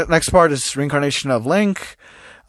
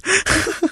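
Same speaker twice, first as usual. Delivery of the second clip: high giggle. Real, not acted. Laughing at own edit.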